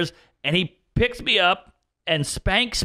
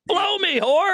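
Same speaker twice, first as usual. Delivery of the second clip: high, laughing jab; laughing insult; 12 semitones higher, slower than usual. Acted. Telling off critics, laughing and high. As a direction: toss off a joking insult.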